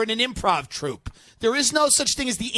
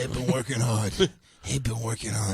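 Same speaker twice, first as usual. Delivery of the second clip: mock executive; 11 semitones lower, 5 dB quieter than usual. Voicing the executive defending himself.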